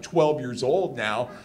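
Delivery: normal talking voice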